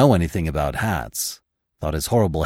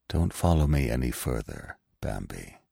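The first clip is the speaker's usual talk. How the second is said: low, soft and calm farewell; 7 semitones lower, 5 dB quieter than usual. A narrator's reading. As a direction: calmly send the young one away. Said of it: "he began with a calm voice".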